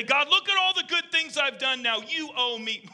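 high-pitched self-congratulating claim; mock boasting. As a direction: play the entitled bargainer, half laughing at the end. Boastful demand to God, acted.

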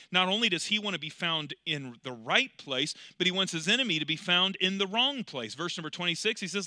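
ordinary delivery

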